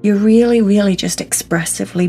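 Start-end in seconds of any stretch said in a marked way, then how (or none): none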